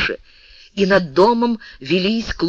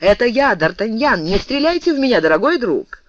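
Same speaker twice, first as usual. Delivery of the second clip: high-pitched calling out; raised, high, pleading cry; urgent call; 7 semitones higher than usual. A narrator's reading. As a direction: call up urgently to your friend. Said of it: "и закричал".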